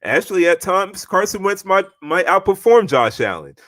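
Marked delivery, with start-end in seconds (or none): none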